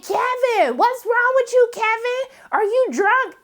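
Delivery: high-pitched panicked yelling, hammed up; mock scream. [name] shrieking at drunk Kevin before his teacher, hammed-up high yell. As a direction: shriek his name like a worried girlfriend. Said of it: "do you need to scream it".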